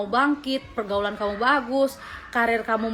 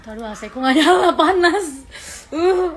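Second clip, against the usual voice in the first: hot and exasperated; 7 semitones higher, 10 dB louder than usual. Real, not acted. Complains AC broken, hot.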